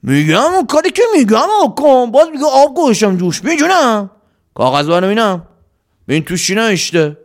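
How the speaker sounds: bossy leader, demanding and impatient; a lightly voiced bandit boss interrogating; gruff bandit chief. A narrator's reading. Salim orders Qasem to read.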